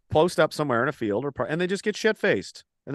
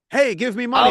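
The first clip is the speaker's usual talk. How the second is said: mock beggar; 8 semitones higher, 6 dB louder than usual. Mocking grifters begging for cash.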